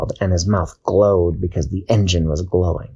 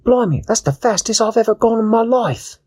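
much higher British pirate cheer; British cat; 13 semitones higher than usual. Acted. Kwazii urging Dashi to test her wings, high British voice. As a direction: egg her on like a cockney cat. Kwazii after the fast trip.

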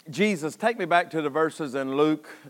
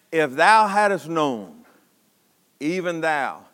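slow, measured reading with long pauses; solemn scripture reading; 3 semitones lower, much slower than usual. Real, not acted. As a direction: let each phrase of the verse stand alone. Reads scripture aloud.

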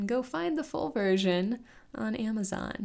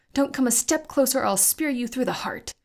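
high, loud warning with a shaky edge; threatening yell; 6 semitones higher, 5 dB louder than usual. Acted. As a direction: hold the spear and bluff hard. Talay threatens the orc.

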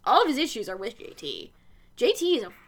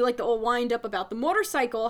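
normal talking voice

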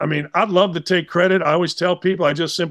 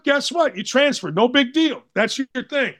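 usual speaking voice

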